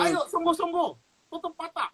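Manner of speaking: high preacher's urging; rally the room to pray. Retold or imitated. Pastor urges the kneeling crowd, in a high preacher call. Pastor leads altar prayer.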